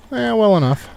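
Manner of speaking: high, bright reply for the horse; voicing the horse. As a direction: answer as the horse would. Answering for the horse.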